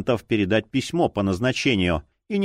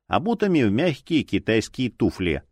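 usual speaking voice

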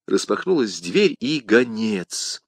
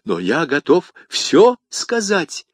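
slow, higher-pitched exclamation; exclaiming; 7 semitones higher, 3 dB louder, slower than usual. A narrator's reading. Bonacieux offers the commissar all he knows, exclaiming. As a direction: drag out an eager exclamation. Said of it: "воскликнул Бонасье".

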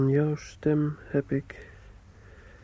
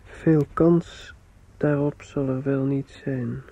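usual speaking voice

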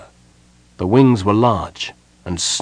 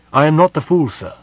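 raised, loud objection; higher, forceful knight's rebuttal; indignant retort; 7 semitones higher, 4 dB louder than usual. A narrator's reading. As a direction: push back firmly at a superior. Knight argues back at mage.

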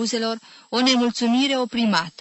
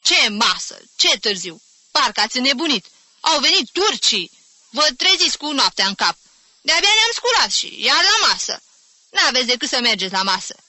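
irritated, raised-pitch fragments; cross, rising tirade; angry grumbling; 5 semitones higher, 4 dB louder than usual. A narrator's reading. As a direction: grouse furiously at being woken. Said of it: "bombănite de mânie".

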